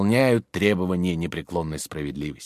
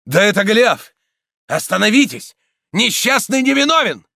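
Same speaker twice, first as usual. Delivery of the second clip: high, loud call to stop; urgent outcry; 11 semitones higher, 6 dB louder than usual. A narrator's reading. Priest crying out to the crowd to stop, urgent shout. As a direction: shout to halt the mob. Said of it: "в толпе раздался возглас".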